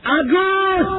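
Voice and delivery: high shout down to a friend; shouting again. Retold or imitated. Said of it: "Dia teriak lagi".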